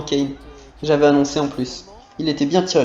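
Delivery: usual speaking voice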